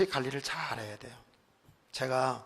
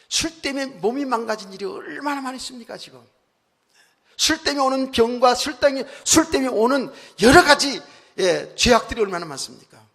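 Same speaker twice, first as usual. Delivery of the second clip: loud, high, insistent exhortation; real pulpit outcry; forceful preaching; 10 semitones higher, 12 dB louder than usual. Real, not acted. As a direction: drive each point home to the congregation. Preaches against alcohol at full force.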